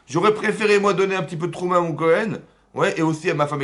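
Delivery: plus haut et plus fort, avec élan; défends ton point avec passion; heated outburst. Real, not acted. Heated point about giving. (éclat passionné)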